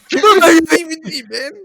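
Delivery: high, loud mock yell; heckling shout. Friends heckle the actor about his DVD, high shouting. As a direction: holler it over the laughter.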